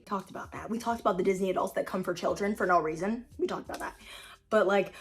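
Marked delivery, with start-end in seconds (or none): 3.4-4.0 whispered under the breath; a quick whisper to herself; whispered aside. Real, not acted. Reminding viewers of an earlier video, whispered.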